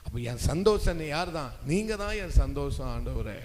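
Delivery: normal talking voice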